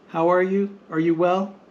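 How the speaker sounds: ordinary talk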